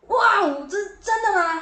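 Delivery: a re-enacted yelp of disbelief at the news; shouting in delight after hearing she got selected; retold shout. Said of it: "大叫".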